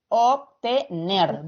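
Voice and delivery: Obtener said slowly, syllable by syllable, NER stressed; ob-te-NER dictated slowly. Shown as a demonstration. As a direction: drag out Obtener for the class. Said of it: "Dónde se carga la voz".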